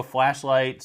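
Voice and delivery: ordinary talk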